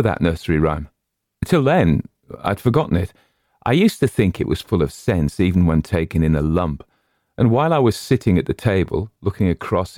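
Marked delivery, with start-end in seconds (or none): none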